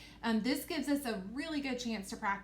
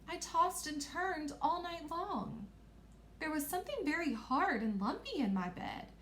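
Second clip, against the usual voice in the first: princess voice; 6 semitones higher than usual. Acted. Princess telling hosts of a bad night, high-voiced. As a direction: raise the pitch and play the princess. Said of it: "I made my voice go up".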